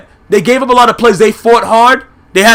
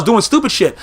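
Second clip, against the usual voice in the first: usual speaking voice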